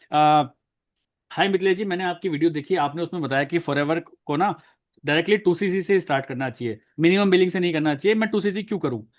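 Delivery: usual speaking voice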